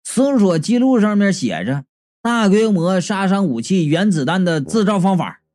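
ordinary delivery

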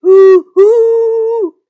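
very loud, high long hoot; teasing ghost howl. A narrator's reading. Brother scaring his sister, loud ghostly howl. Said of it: "他想再逗逗小熊妹妹".

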